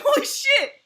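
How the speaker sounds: high, loud shocked cry; hammed-up shocked yelp; stunned exclamation. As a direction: blurt it out in amazement. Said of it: "I was like".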